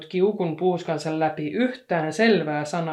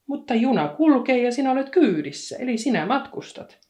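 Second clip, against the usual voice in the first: put-on bright little girl; 8 semitones higher, 5 dB louder than usual. A narrator's reading.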